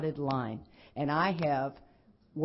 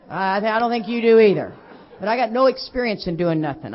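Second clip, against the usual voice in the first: emphatic; 2 semitones higher, 11 dB louder than usual. Real, not acted. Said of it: "emphatic to the audience".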